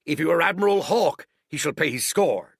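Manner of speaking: put-on loud, high old sea cook; a pirate cook calling out hot; Silver crying out. A narrator's reading. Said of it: "cried Silver".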